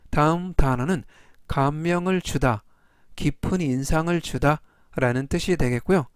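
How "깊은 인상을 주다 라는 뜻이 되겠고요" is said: ordinary talk, nothing marked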